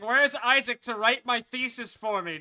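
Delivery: slow, loud whiny complaint; mock whiny voice. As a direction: whine it theatrically. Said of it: "I was like".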